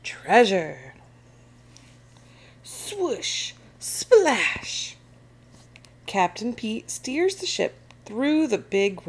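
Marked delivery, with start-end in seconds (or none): 0.0-0.9 crew's cry. A narrator's reading. Pirate crew setting off on a hunt, crying out. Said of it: "cries the crew". 2.6-5.0 high, drawn-out swoosh, then louder splash; voiced sound effects. A narrator's reading. Storyteller voicing the ship setting off, sound effects.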